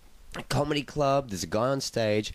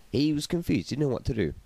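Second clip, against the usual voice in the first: ordinary talk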